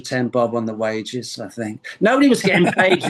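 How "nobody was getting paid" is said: emphatic outburst. Real, not acted. Emphatic aside about no pay.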